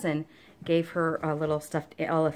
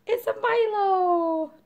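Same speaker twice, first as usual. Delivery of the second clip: high, slow squeal of delight at the dog; excited pet greeting; 14 semitones higher, 6 dB louder, much slower than usual. Real, not acted. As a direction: stretch it out, thrilled to see the dog.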